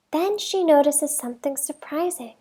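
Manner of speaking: ordinary delivery, nothing marked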